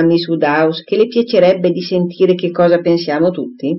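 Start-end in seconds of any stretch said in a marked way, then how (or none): none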